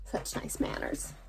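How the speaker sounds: ordinary delivery, nothing marked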